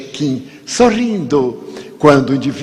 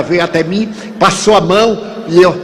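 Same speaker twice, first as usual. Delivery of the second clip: ordinary talk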